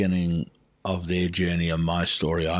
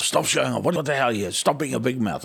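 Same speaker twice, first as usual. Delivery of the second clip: scolding dad; 5 semitones higher than usual. Retold or imitated. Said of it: "My old man used to constantly".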